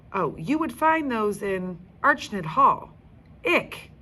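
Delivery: a grown-up explaining then grossed out; chatty guide. Acted.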